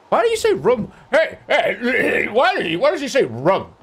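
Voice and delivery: high, loud whining complainer; high-pitched hammed-up outrage; mock outraged heckler. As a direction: shriek it like an indignant critic. Mocking outraged chat complainers.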